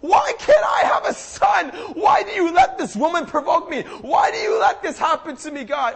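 loud, high desperate wail to God; anguished pleading. Retold or imitated. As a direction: beg God loudly through anguish. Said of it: "crying out to God in anguish".

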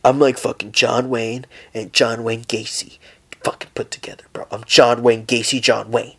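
low, soft menacing bro voice sinking into a whisper; tough-guy bro. Retold or imitated. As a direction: play a macho guy trying to sound dangerous. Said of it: "someone who's like this fucking".

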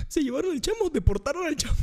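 high-pitched mock wail; mock crying. Drunk man fearing his friend was deported, high mock crying. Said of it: "Yo llorando por el Chamo".

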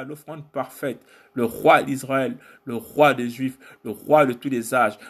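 rhythmic litany of divine titles; spoken worship in short, driving phrases; spoken praise. Real, not acted. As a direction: declaim each title as praise to God. Declaims titles of God.

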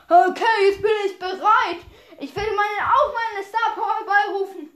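loud, eager hero shout; excited hero. Acted. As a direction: call it out, fired up. Hero powering up.

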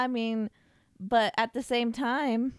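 usual speaking voice

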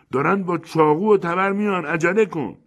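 high, loud cry of warning; urgent alarm. A narrator's reading. Great Yakuma warning that armed natives are coming, urgent alarm. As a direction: hurry them along, voice pitched right up. Fleeing king warns of armed attackers.

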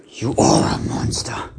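slow awed whisper. Acted. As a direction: breathe it out in disbelief. Awed line in the fight.

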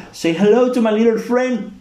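high, slow, hammed-up movie gangster; Scarface quote. To friends, quoting Scarface in a hammed-up gangster voice. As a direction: ham up Tony Montana's line. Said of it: "en inglés dice como".

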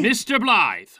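stern shout. Acted. Teacher snaps at boy.

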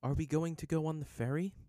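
polite, high-voiced inquiry; polite question. A narrator's reading. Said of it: "Yue questioned politely".

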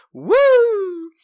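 very high, loud held whoop; imitated police siren. Cops turning the corner, wailing siren noise. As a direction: siren wail done by mouth.

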